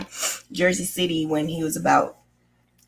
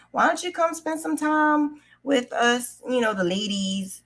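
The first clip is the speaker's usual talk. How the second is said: light higher sweet coaxing voice for the aunt, lightly voiced; imitating aunt; 8 semitones higher than usual. Aunt inviting niece to ladies' crochet club, sweetly coaxing.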